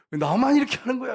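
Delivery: high, loud mock indignation; imitated resentful outburst. Deacon fumes at being the only one working, mock angry protest. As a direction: act out anger boiling up at unfairness. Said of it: "이러면서 막 분노가 막".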